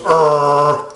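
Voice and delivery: playful imitated dog snarl; mock dog growl. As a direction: growl like a dog guarding its bone. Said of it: "the dog goes".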